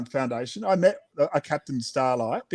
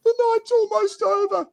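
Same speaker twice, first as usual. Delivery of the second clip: much higher cow character voice. Acted. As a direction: voice the tearful cow high. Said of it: "she said".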